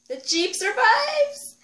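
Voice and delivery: thrilled. Real, not acted. Thrilled the Jeep starts.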